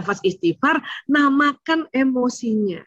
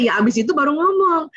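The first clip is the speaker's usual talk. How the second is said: normal talking voice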